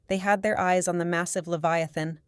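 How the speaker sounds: plain reading voice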